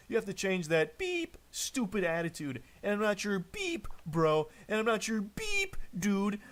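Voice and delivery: voiced high beeps cutting into a loud rant; self-censored rant. Mocking a boyfriend who beeps himself, high censor-beep imitation. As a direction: mimic a censor beep in place of the swear words. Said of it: "He's literally beeping himself with a sensor".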